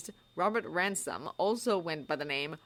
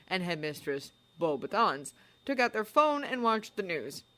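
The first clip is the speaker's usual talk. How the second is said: ordinary delivery, nothing marked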